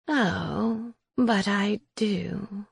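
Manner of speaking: soft, slow, knowing crone; a quiet, slow witch reply; old witch voice. Acted. Witch Raisa answers at door.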